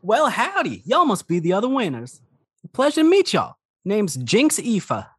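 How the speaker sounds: high-pitched cowboy halfling voice; folksy halfling sheriff. Acted. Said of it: "the halfling kind of turns to face y'all and just goes".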